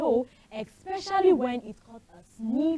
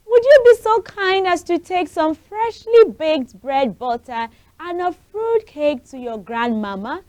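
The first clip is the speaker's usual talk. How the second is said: mother's voice; 7 semitones higher, 7 dB louder than usual. Acted. Mother voiced in read story.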